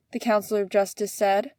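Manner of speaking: usual speaking voice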